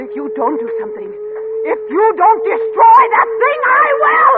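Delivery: building from firm to loud, high cry; wife vowing to act herself, climbing to a shout; rising threat. Acted.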